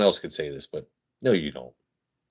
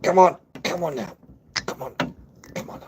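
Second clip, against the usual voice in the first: coaxing, fading to whisper; 8 semitones higher than usual. Real, not acted. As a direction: coax the dog, then drop to a hush.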